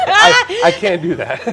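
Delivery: laughing. Real, not acted. Psychic joking with the interviewer about guessing, laughing. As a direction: let the joke break into a loud laugh.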